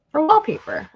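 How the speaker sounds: scandalized by the price; incredulous repeat. Real, not acted. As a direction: repeat it in outraged disbelief.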